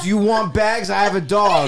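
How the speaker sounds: loud, high kind offer yelled in anger; nice words, angry voice. Retold or imitated. Offering the dog owner bags, still in the angry voice. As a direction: offer bags but keep yelling. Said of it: "with the angry voice".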